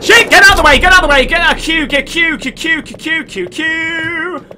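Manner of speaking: high loud frantic yelling; being chased. Real, not acted. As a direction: shout in panic while fleeing.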